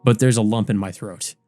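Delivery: ordinary delivery, nothing marked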